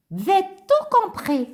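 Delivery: high, bouncy little puppet voice; high childlike puppet greeting the class. Acted. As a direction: play the cheeky puppet pupil. Ratoun puppet talking.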